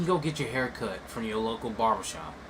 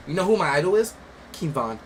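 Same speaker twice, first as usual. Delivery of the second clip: imitated kid; 6 semitones higher, 7 dB louder than usual. Imitating a kid from a viral video, mock bragging. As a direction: put on a kid's emphatic brag.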